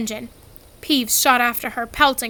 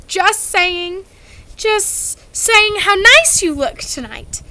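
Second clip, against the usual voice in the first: strained cover; 8 semitones higher, 5 dB louder, slower than usual. A narrator's reading. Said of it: "glaring at Peeves".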